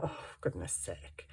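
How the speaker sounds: breathy whispered exclamation, no voice at all. Retold or imitated. Recalling her bleeding finger to viewers, whispered exasperation. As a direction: mutter it under your breath. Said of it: "So I'm like".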